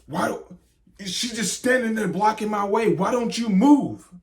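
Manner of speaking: mock an annoyed diner snapping; an annoyed diner blocked by the wife, complaining loudly; rude demand. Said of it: "this guy comes up and he's like".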